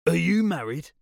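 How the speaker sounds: put-on loud and high, slurred drunk; a drunk blurting out a question; drunk man voice. Drunk Johnny asks narrator.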